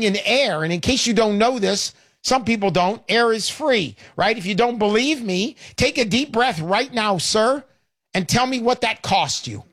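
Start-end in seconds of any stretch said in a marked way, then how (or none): none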